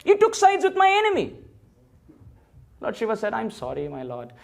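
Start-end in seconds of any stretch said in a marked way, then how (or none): 0.1-1.3 high, sharp rebuke; Krishna scolding Shiva for siding with his enemy, high and sharp; reproachful Krishna. Acted. 3.3-4.3 contrite apology. Acted. Shiva apologising to Krishna.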